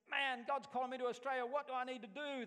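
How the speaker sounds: high, loud, breathless eagerness; eager. Retold or imitated. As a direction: burst in like a thrilled new convert. Eager young convert asking department.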